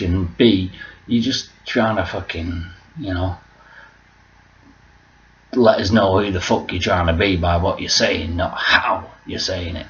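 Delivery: ordinary talk, nothing marked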